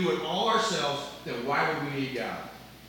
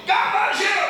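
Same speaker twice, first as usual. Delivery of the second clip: much higher and louder, bursting; loud excited God loves you; 8 dB louder than usual. Shown as a demonstration. Pastor contrasting gospel delivery for the church, loud and high. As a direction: proclaim it with big excitement. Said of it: "We can tell people".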